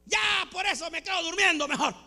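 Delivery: high, fast acted protest; mock indignant refusal. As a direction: refuse hotly, like someone quitting in a huff. Acted sulky refusal to serve. (protesta fingida, indignada)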